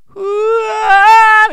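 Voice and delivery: high, very slow hoo turning to wah; falsetto to mix flip. Shown as a demonstration. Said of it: "ふーわーみたいな感じで". (裏声から地声へ返す)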